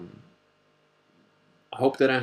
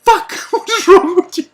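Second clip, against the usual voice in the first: high, loud yell broken by a laugh; family laughing at the brother in disbelief; 13 dB louder than usual. Retold or imitated. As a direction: shout it half laughing in relief. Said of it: "we're like".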